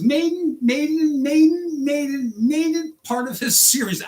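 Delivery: stuck on a word from sheer anger; furious. Real, not acted. Driver ranting on his first video, sputtering furious. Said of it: "angry as a person could possibly be".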